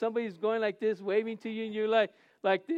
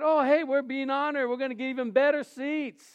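excited; 5 semitones higher, 4 dB louder than usual. Retold or imitated. Retold eager thought of honor.

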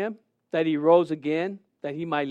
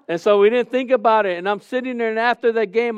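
ordinary talk

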